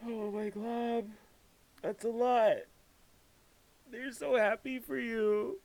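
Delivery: overwhelmed, voice cracking with emotion; emotional overwhelm. Real, not acted. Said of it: "I can't".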